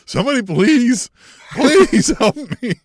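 high, loud, slow plea breaking into laughter; mock begging. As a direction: beg for rescue, hammed-up. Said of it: "where Jake's like".

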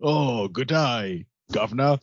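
slow hammed-up British accent; mock British accent. Co-host joking as a Brit, slow mock British accent. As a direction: lay on a silly British accent. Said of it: "doing his British accent".

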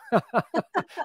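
high-pitched laugh at own joke; real amused laugh, pitched high. Real, not acted.

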